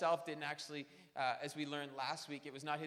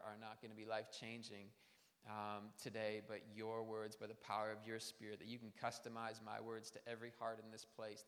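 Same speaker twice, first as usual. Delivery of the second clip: soft, low prayer voice, speaking to God; quiet prayer; 5 semitones lower, 3 dB quieter than usual. Acted. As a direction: pray quietly and sincerely. Pastor leads prayer.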